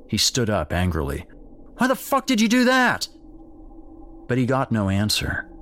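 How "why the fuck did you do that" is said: very high, loud, enraged shout; furious dad. Acted. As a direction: scream at her in fury.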